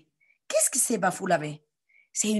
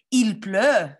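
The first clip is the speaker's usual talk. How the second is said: louder call; 5 dB louder than usual. Shown as a demonstration. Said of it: "Répétez".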